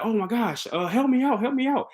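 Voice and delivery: mock plea for help. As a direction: imitate someone begging for rescue in a high voice.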